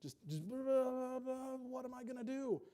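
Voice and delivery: high rising panicked babble; mock frantic babble. Preacher mimicking a panicking believer, high frantic babble. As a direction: babble like a headless chicken. Chicken with head cut off.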